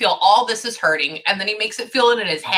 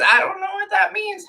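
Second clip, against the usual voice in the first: much higher, rising disbelief; bewildered repeat; 12 semitones higher than usual. Retold or imitated. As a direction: repeat it pitched far up, baffled. Repeats the puzzled reply.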